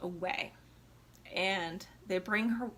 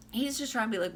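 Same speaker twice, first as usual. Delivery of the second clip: ordinary delivery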